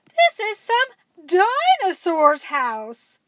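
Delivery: slow and loud, the penny dropping; alarmed realization. A narrator's reading. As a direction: spell out the danger slowly. Girl realizes danger.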